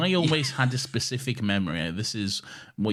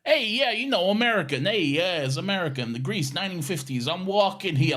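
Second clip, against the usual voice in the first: higher, sing-song fifties American accent; bad American accent; 10 semitones higher, 4 dB louder than usual. Shown as a demonstration. Podcaster showing his school Grease accent, exaggerated American. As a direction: ham up a clumsy American accent, pitched high. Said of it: "I had to do an American accent".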